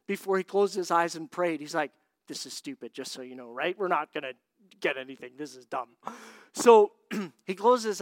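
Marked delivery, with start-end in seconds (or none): 2.3-5.9 low, soft scoffing aside; dismissive. Retold or imitated. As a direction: brush it off under your breath. Skeptic dismissing the exercise.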